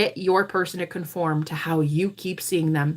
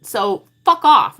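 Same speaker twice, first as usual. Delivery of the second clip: defiant shout; 3 semitones higher, 9 dB louder, slower than usual. Real, not acted. Defiant outburst at doubters.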